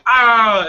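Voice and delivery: short, loud, high mock yelp of pain; quick cartoonish cry of pain. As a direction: yelp like a hurt gangster, hammed-up. Boss's fingers chopped off.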